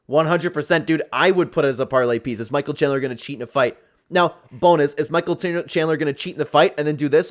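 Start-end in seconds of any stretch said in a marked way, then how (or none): none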